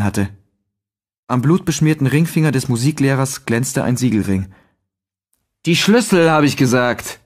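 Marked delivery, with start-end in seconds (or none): 5.7-7.2 angry demand. A narrator's reading. Neri demands the keys again.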